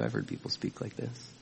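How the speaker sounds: a whispered confession; whispered aside. Real, not acted. Preacher whispering to the congregation, confiding. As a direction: lean in and whisper it to them.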